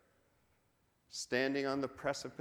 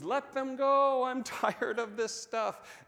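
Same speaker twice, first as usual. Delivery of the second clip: hammed-up scream, much higher, louder and slow; Pharaoh screaming; 10 semitones higher, 6 dB louder, slower than usual. Pharaoh giving in to Moses, mock exasperated scream. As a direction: play Pharaoh finally cracking and yelling, with a laugh. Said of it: "Pharaoh screams".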